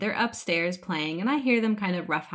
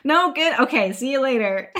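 laughing; 6 semitones higher, 7 dB louder than usual. Retold or imitated. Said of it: "I'm like".

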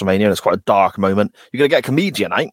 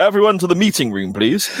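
formal announcement; 9 semitones higher than usual. Pretend. Mock announcement to family.

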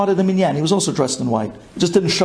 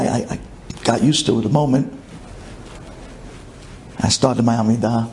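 ordinary talk, nothing marked

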